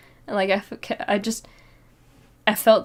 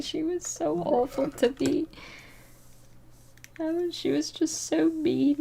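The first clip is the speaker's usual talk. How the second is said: higher, softer, a touch of a teary whimper; retold crying; 7 semitones higher, 4 dB quieter than usual. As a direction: replay the upset daughter complaining to mom, lightly. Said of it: "I just started crying to her".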